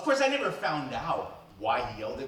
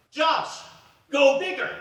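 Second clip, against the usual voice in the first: high, loud push to go bigger; Josh, go bigger, called out high and loud; mentor urging louder; 11 semitones higher, 8 dB louder, slower than usual. Retold or imitated. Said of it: "And Bruce said".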